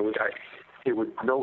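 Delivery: ordinary talk, nothing marked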